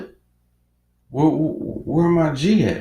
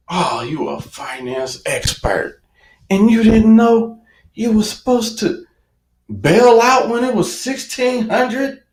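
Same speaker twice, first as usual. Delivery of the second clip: mocking himself; 9 semitones higher than usual. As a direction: taunt yourself for missing it. Self-mocking quote.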